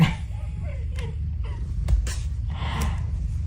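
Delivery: airy squeaky laugh; high breathy giggle. Real, not acted. Laughing at the cat video.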